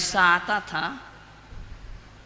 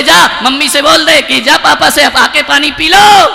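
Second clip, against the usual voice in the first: 1 mocking father; 5 semitones higher, 10 dB louder than usual. बोले.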